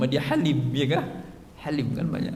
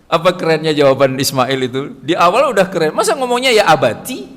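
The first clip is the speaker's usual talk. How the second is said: excited preaching; 3 semitones higher, 9 dB louder than usual. Acted. Preacher stresses Ismail's answer.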